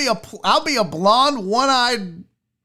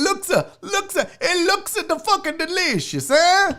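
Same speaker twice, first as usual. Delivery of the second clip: high, laughing hammed-up Italian accent; bad Italian accent; 10 semitones higher than usual. Host praising pizza in a bad Italian accent. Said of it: "My Italian is as bad".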